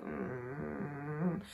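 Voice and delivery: low, very soft drawn-out "mm"; awkward vocal-fry groan. Shown as a demonstration. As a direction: demonstrate a quiet, awkward creaky hum. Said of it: "that's what I do without even noticing".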